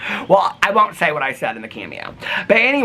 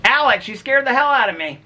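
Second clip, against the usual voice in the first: startled; 11 semitones higher than usual. Real, not acted. Repeats the startled line.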